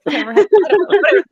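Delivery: loud, high, rushed through laughter; words tumbling out in a laugh; laughing while talking. Real, not acted. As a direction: crack up mid-sentence. Breaking up while ranting about book.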